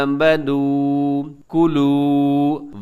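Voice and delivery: slow आमनू कुलू with each long ऊ held out; lengthened vowels. Shown as a demonstration. Teacher modelling madd for students, long vowels in आमनू कुलू. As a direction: stretch the आ of "आमनू" and end of "कुलू". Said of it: "इसे भी लंबा कीजिएगा".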